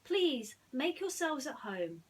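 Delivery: slow, high, polite hostess; graciously, taking her time; Jasmine, gracious. Acted. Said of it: "said Jasmine".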